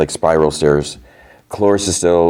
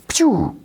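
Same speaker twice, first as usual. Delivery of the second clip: very high "pew" laser noise; imitated blaster shot. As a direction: mouth a sci-fi gun zap.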